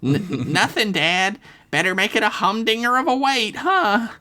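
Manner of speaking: put-on high, forced chirpy kid voice; cheesy fake cheer. A narrator's reading. Dev covering up to his dad, high and falsely cheery. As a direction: cover up with a fake-smile lilt. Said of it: "Dev said with a cheesy fake smile".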